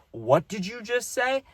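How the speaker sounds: high, slow, stern dad voice; dad's stern question. Retold or imitated. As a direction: play the shocked strict father. Dad confronting son about swearing.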